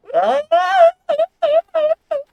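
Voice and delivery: sudden high shrieking cackle; a big squeaky laugh; cackling laugh. Real, not acted. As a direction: burst into a loud, high cackle. Bursts out laughing at herself.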